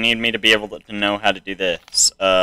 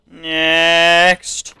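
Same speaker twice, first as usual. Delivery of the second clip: higher, drawn-out "Next" call; louder call; 7 semitones higher, 4 dB louder than usual. Pretend. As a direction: call it out again, higher and longer. Repeats call to next entrant.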